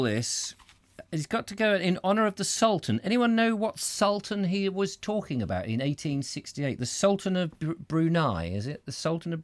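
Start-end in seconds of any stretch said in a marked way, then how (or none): none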